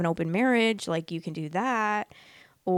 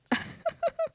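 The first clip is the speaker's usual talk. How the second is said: high squealing laugh; 3 dB quieter than usual. Real, not acted. Laughing at the Hawk Tuah status, high squealing laugh. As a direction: burst of high-pitched laughter.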